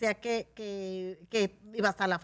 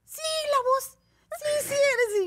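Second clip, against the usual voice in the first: much higher and louder, eager fan; insistent fan; 7 dB louder, slower than usual. Retold or imitated. Fan recognizing her voice, high insistent squeal. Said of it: "y yo, "No".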